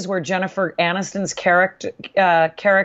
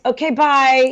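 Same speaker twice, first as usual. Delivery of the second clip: mock dismissal; 5 semitones higher, slower than usual. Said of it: "I'm gonna take your advice".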